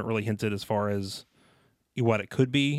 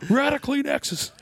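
much higher, laughing mom voice; 5 dB louder than usual. Acted. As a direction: squeal it like a shocked mom.

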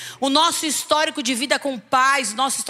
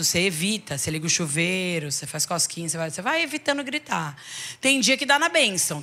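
usual speaking voice

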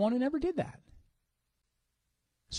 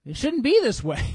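louder, higher rebuke; James's rebuke; 6 semitones higher, 5 dB louder than usual. Retold or imitated. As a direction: declare it like a stern apostle. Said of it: "James just goes".

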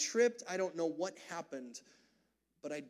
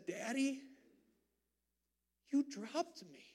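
put-on child's hurt question; 6 semitones higher, 5 dB quieter, slower than usual. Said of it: "And he said".

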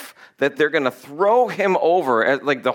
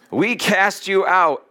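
slow, higher proclamation; mock exorcist; 5 semitones higher, slower than usual. Brothers casting out the demon, mock solemn. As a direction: intone the command grandly and slowly.

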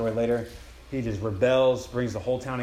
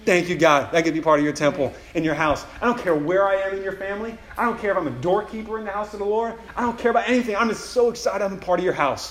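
louder, overjoyed thanks to God; excited thanks; 7 semitones higher, 6 dB louder than usual. Acted. Believer thanking God for a place in his house, overjoyed. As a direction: gush with grateful excitement. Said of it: "I feel so excited".

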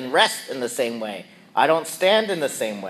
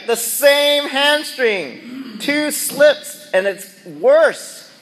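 exasperated; 11 semitones higher, 5 dB louder, much slower than usual. Retold or imitated. Exasperated complaint.

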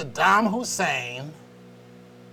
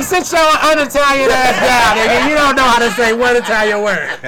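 mock scolding; 7 semitones higher, 10 dB louder than usual. Retold friend scolding him, loud.

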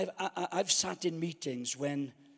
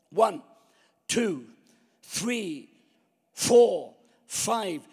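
shouted referee count; 3 semitones higher, 7 dB louder, much slower than usual. Acted. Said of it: "The referee starts the count".

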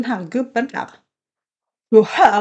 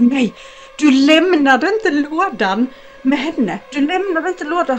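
higher, louder and slow, urgent; alarmed warning; 5 semitones higher, 4 dB louder, slower than usual. Retold or imitated. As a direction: plead with him, sounding scared. Friend panics on the phone. (skrämd varning)

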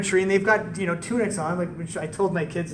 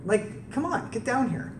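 higher, calling up to the tree; Jesus calling up; 5 semitones higher than usual. Retold or imitated. Jesus calling Zacchaeus down from the tree, lightly voiced. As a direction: friendly call up into the branches. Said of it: "he says".

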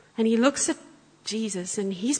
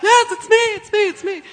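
much higher, louder eager cry; excited yes; 12 semitones higher, 10 dB louder than usual. Retold or imitated. Husband shouting to the speaker that the dog is his, excited. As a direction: jump up calling it's me. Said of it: "putting his hand up".

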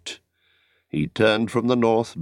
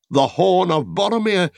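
loud, high cry of alarm; cried out; 10 semitones higher, 3 dB louder than usual. A narrator's reading. Aragorn hears his friend's horn and cries out in alarm. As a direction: shout it out as you recognise the horn. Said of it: "he cried".

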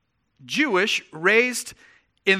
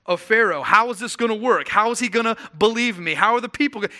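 a touch of panic, louder and higher; panicked Moses; 5 semitones higher, 6 dB louder than usual. Retold or imitated. Moses protesting to God, higher and anxious. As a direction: pile up nervous questions. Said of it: "So Moses is like".

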